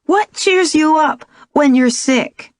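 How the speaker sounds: put-on child asking a riddle; little girl voice. A narrator's reading. Young daughter voiced by narrator.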